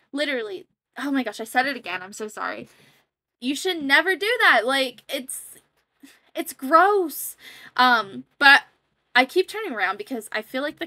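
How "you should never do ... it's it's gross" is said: higher and louder, scolding; indignant. Real, not acted. Vlogger scolding people who hate on excitement, loud and high. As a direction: call out the haters. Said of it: "scolding haters".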